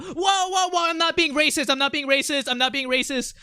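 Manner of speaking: high, rapid-fire protest; urgent protest. Real, not acted. Streamer heading off chat's accusation of racism, urgent protest. Hurried self-defence to chat.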